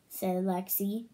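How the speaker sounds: usual speaking voice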